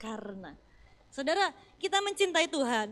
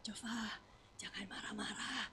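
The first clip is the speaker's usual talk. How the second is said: put-on soft, hushed whisper to a child; imitated parental whisper, much softer; 6 semitones lower, 16 dB quieter than usual. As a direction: coax the child in a low whisper. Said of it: "saya coba bisik-bisik".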